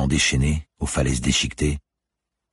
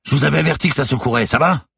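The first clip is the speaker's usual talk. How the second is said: quick, high, loud call; urgent raised voice; shouting over storm; 13 semitones higher, 5 dB louder than usual. A narrator's reading. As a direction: yell it fast over the waves.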